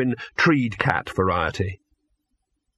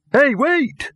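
much higher call after someone leaving; 4 dB louder than usual. Acted. Victor calls after the departing dog, high and loud. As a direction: shout after him to wait.

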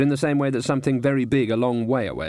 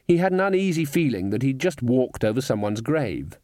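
ordinary delivery

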